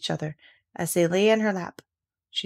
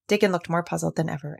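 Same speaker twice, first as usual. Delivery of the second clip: ordinary delivery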